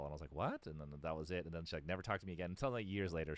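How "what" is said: baffled question. Retold or imitated. Retold baffled reply to her.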